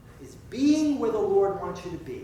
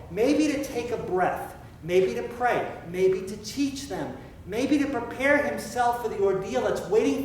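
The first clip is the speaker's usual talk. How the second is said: preaching; 3 semitones higher, 4 dB louder than usual. Acted. Preaching at full force.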